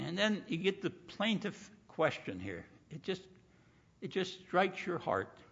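normal talking voice

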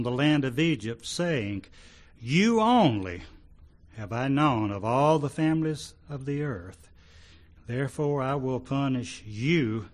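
loud, declaimed prophecy; scripture reading. Acted. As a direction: proclaim God's word to the congregation. Reads prophet's words to congregation.

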